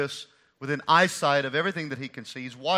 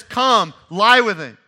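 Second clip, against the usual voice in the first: emphatic retelling; 2 semitones higher, 10 dB louder, slower than usual. Retold or imitated. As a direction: declaim it loud from the pulpit. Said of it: "Amnon says".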